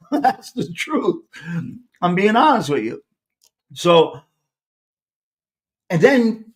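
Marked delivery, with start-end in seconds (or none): 0.0-1.2 laughing. Real, not acted. Laughing as he admits it.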